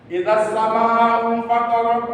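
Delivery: slow chanted Idza sama'u fatarat, every letter drawn out; Quranic recitation. Shown as a demonstration. Teacher reciting the verse for students, slow chant. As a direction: recite it slowly as in tajwid class. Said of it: "recitation demo".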